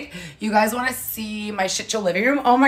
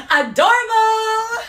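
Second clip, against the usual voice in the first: excited coo; 13 semitones higher, 5 dB louder, slower than usual. Real, not acted. Cooing at a pet.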